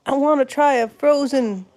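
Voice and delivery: ordinary delivery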